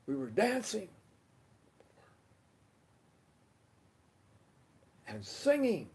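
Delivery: high, lifted, delighted emphasis; exuberant recollection. Real, not acted. Recalling Wednesday night's get-together, exuberant and high. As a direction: punch dancing and singing up high.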